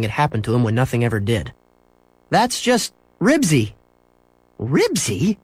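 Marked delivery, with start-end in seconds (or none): 2.3-3.7 high, slow, falling statement; explaining boy. A narrator's reading. Boy explaining the noise to mother, matter-of-fact. 4.6-5.4 high, rising incredulous question; exasperated mother. A narrator's reading. Mother questioning son about strange name, exasperated. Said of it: "His mother was exasperated".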